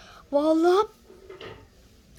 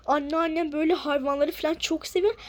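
usual speaking voice

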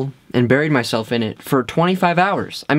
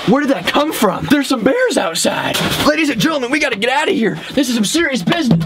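hammed-up panic, higher pitch; mock alarm; 8 semitones higher than usual. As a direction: play a fake scare, pitched up. Pretend bears outside.

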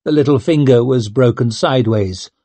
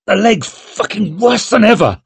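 much higher, bitter outburst; furious pain; 9 semitones higher than usual. Acted. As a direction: rage at the wounded leg. Leo in pain and fury.

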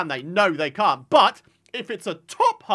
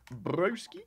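Broski? repeated softer with a questioning rise; puzzled echo; 6 dB quieter than usual. Shown as a demonstration. Streamer puzzling over a viewer's word, quizzical.

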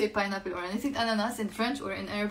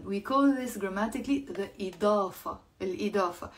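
normal talking voice

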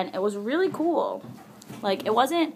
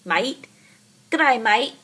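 hammed-up Australian accent; 2 semitones higher, 5 dB louder than usual. Shown as a demonstration. Vlogger imitating Australians for viewers, mock Australian accent. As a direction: put on a stock Aussie greeting. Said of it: "that's Australian".

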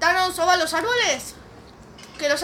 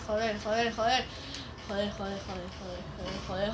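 muttered, soft repeated curses; cursing under breath; 8 semitones lower, 10 dB quieter than usual. Real, not acted. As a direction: grumble it low to yourself.

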